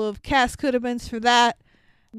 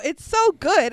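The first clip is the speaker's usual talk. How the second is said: ordinary talk, nothing marked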